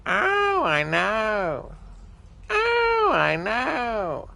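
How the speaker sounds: parrot mimicking. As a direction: squawk it like a parrot on the phone. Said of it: "the parrot is like".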